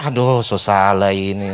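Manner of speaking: loud, low, drawn-out groan of dread; mock dismay. Groom-to-be fearing the old man disapproves, loud mock dismay. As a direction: groan it slowly for laughs. Comic inner dread, retold.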